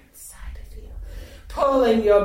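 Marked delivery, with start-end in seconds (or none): 0.1-0.9 whisper. Acted. Intimate erotic roleplay.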